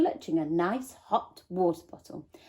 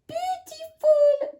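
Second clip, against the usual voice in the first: put-on very high, slow baby coo; 3 dB louder, slower than usual. A narrator's reading. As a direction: coo it like a toddler copying. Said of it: "cooed the baby".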